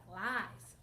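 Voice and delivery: normal talking voice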